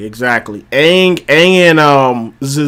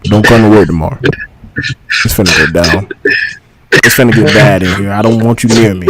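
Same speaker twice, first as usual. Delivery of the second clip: low ominous warning; 7 semitones lower than usual. Retold or imitated. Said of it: "that same energy of".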